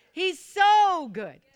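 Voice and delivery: slow, stretched shout with a long so; say it like you mean it. Real, not acted. Preacher repeats shouted praise.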